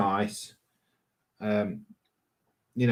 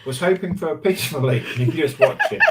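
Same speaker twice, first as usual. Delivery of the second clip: laughing while reading; 6 semitones higher, 5 dB louder, faster than usual. Real, not acted.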